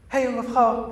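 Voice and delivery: usual speaking voice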